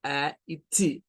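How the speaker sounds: "A y T" said slowly as two separate sounds, A then T; slow, split apart: "A y T"; separated sounds. Shown as a demonstration. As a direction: pull "A y T" apart into two clear pieces. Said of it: "los digo separados".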